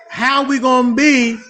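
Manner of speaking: ordinary delivery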